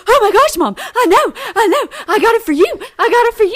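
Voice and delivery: high, loud, excited dog voice; voicing proud Charlie. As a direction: play an eager dog proudly showing off a catch. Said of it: "and Charlie's like".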